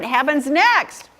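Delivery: usual speaking voice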